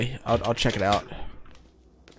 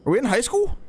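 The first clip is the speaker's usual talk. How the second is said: surprised; 11 semitones higher than usual. Real, not acted. Startled realization in game.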